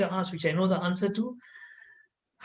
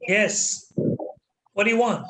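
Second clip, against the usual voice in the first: imitated stern question, slightly raised; stern teacher; 3 semitones higher than usual. Discipline master looks down at pupil, mildly stern. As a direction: put a touch of stern authority in it. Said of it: "stern look on his face".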